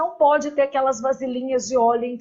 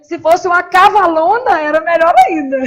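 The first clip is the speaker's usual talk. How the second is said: loud, higher-pitched joking exclamation; 3 semitones higher, 9 dB louder than usual. Real, not acted. Teacher jokes about wishing to be called cavalona, loudly amused. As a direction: belt out the joke, about to laugh.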